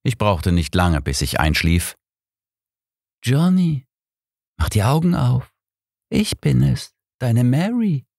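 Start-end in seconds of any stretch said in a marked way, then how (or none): 3.2-8.1 seductive whisper. Acted. Dead Mary wakes Johnny, seductive high voice. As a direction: coax him awake as a ghostly lover. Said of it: "flüsterte eine verführerische Stimme".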